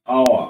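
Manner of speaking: "আওয়া" ending on an open a, no r; loud model "আওয়া" with the r left silent; dropped final r. Shown as a demonstration. Teacher showing the r disappears, "আওয়া" with open ending. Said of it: "লোপ পেয়ে যাবে যার মানে".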